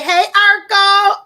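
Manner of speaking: loud, slow put-on greeting; imitating Shay Shay. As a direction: draw out "Hey, Urkel" in a borrowed voice. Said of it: "how to do the Shay Shay say".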